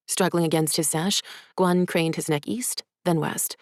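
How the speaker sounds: plain reading voice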